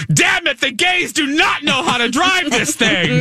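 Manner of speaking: high, exasperated shout, hammed up; mock screaming. Haley yelling at both pilots from behind, mock screaming. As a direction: imitate a best friend screaming in exasperation. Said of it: "Haley's screaming at the both of us".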